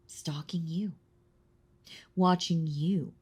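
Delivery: secretive whisper. Acted. Vampire confessing her stalking, hushed whisper. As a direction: let it slip out in a whisper.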